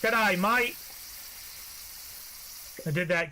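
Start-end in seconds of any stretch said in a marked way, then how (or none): 0.0-0.7 a quick, light Australian "G'day, mate"; tossing off a cheery Aussie greeting; Australian accent. Pretend. Asked for accents, offers a light Australian greeting. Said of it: "You want accents?".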